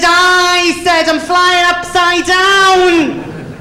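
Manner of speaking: very high, drawn-out mock wail; hammed-up frantic distress, stretched out; comic panic. Novice flyer reporting the dead pilot, comic panic.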